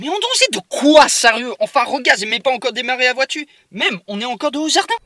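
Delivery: loud, high exasperated protest. Acted. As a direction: argue back, fed up. Son protesting to mother.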